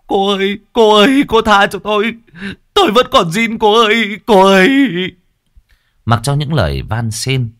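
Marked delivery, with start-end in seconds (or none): 0.1-4.7 high, loud pleading whine; wailing plea. A narrator's reading. Young man begging the ghost girl, high loud wailing plea. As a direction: beg for mercy while running. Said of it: "vừa chạy vừa khóc lóc".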